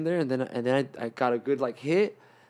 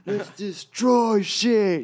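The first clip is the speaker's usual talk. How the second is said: higher, louder, drawn-out hyped shout; amped-up yell; 8 semitones higher, 4 dB louder, much slower than usual. Retold or imitated. High on meth, telling friends to go outside, amped shout. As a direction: explode with sudden rush of energy. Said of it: "to, like".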